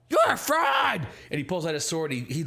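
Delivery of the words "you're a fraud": higher, louder furious accusation; goblin accusation. Acted. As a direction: spit the accusation at him. Goblin accuses before attacking.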